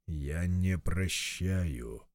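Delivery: low, slow, commanding voice of God; deep divine command, each word weighed; stern God voice. Acted. God refuses forgiveness. (властный голос Бога)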